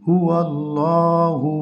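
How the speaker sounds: slow chanted "হুয়াল্লাহু" with a held middle vowel; long madd vowel. Shown as a demonstration. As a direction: stretch the "llaw" in "হুয়াল্লাহু" before a short "hu". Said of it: "এক আলিফ টেনে পড়তে হয়".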